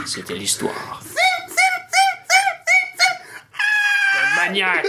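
dark whisper then shrieking scream; creepy movie trailer voice; horror trailer voice. Shown as a demonstration. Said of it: "on est dans une bande-annonce de film d'horreur". (voix de bande-annonce d'horreur)

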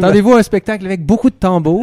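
mock announcer.

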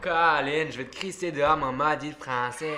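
loud mock-angry Quebec French accent; hammed-up Québécois threat, laughing. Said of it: "Fais un accent québécois". (accent québécois)

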